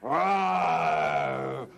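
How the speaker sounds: low, loud roar like a lion. Shown as a demonstration. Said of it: "lions like this".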